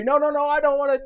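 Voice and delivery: put-on high, loud kid's protest; whining refusal. Johnny refusing the laundry, high loud whine. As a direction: cry out against the chore.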